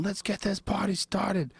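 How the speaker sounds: high, slow, stretched hype call; hammed-up party opener. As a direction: kick the door open and rally the room. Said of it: "he fucking kicks the door open".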